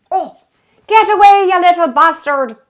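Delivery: loud, high shoo at the little pest; mock shout. Cook shooing a leprechaun from the bread, mock shouting.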